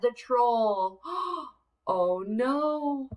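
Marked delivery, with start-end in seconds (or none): none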